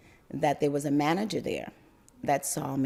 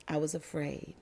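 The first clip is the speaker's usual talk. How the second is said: quiet admission; 9 dB quieter than usual. Real, not acted. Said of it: "I'm gonna share this with you".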